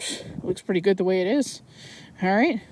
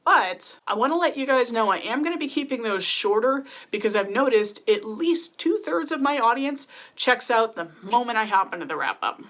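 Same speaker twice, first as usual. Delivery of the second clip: normal talking voice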